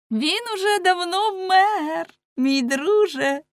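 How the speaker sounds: high, slow, lilting mockery; teasing girl. Girl taking bashful man by hand, teasing high drawl. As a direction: stretch it out in a high girlish voice.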